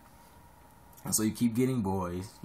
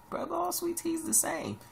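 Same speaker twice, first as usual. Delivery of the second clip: mock know-it-all. Mocking someone who dislikes sweet tea.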